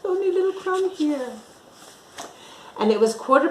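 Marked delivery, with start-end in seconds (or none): 0.0-1.3 very high squeaky mouse; a high, quick little mouse voice. Acted. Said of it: "she says".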